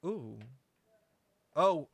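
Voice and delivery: ordinary talk, nothing marked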